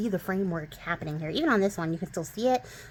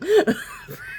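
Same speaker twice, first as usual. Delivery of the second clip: airy burst of laughter; high breathy laugh; 13 semitones higher, 6 dB louder than usual. Real, not acted. Laughing at her own mimicry.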